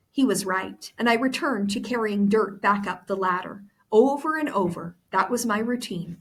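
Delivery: reading the novel aloud to the class; story narration. Acted. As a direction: tell the story with the characters' lines voiced. Teacher reads the novel aloud.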